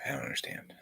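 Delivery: whispered, no voice. Shown as a demonstration. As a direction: breathe it as a whisper. Repeats the line two ways.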